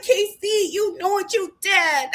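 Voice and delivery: high whiny imitation of a hurt girlfriend; mock tearful accusation. Tearful girlfriend accuses her boyfriend, mock whine. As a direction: accuse him in a put-on whine. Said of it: "Anaya is in tears".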